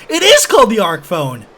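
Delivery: suddenly loud, hammed-up proclamation; proclaiming. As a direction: thunder it out like a grand bestowal. Acts out booming bestowal from game.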